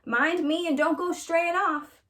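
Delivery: put-on hollering a warning to a wandering child; shouting warning. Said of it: "Grandma shouted after her".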